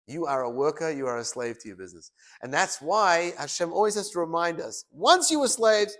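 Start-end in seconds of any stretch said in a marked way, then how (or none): 4.9-5.9 solemn proclamation. Acted. Voicing Hashem's reminder.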